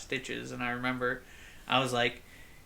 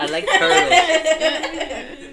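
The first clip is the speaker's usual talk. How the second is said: put-on high, slow laughing kid voice; 14 semitones higher, 12 dB louder, much slower than usual. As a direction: laugh through a kid impression. Imitating the turtles kid.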